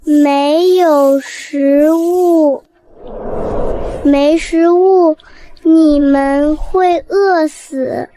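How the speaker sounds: very slow, weighty words; slow serious confession; slow grave reply. Acted. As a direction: let each word drop slowly. Cat explains gravely.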